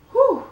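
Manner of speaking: high, loud whew to blow off anger; exhale to calm down. Real, not acted. Vlogger cooling off after a rant, a high loud whew. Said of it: "let me calm down".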